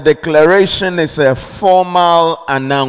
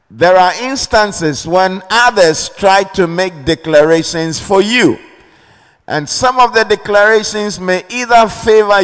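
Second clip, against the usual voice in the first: rising fervent preaching; 4 semitones higher than usual. Acted. Pastor warning the congregation about hostile declarations, fervent preaching. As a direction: pitch climbs higher, preaching with growing force.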